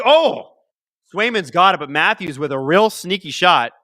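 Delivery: loud burst of excitement at the shot; excited shout. Real, not acted. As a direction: yell it out as the shot goes in. Near goal in live game.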